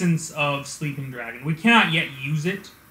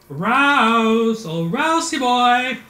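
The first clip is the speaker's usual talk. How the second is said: high, drawn-out singsong call of a mother; mom calling; 7 semitones higher, 4 dB louder, much slower than usual. Retold or imitated. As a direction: call him like a mom from the other room. Mom calling son from afar.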